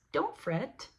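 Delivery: high squeaky little mouse voice; lightly voiced reassuring mouse. A narrator's reading. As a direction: cheer him up like a tiny mouse. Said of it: "Mouse comes in and squeaks".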